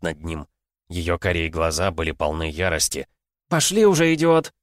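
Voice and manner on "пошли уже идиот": loud high furious yell; angry shout. Acted. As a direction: snap at him, yelling. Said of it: "крикнула она". (гневный крик)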